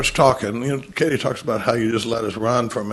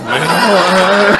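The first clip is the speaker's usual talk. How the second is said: ordinary talk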